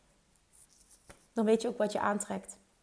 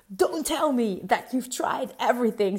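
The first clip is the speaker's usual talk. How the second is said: imitating Tony Robbins; 4 dB louder, slower than usual. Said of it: "dat hij daar schreeuwde".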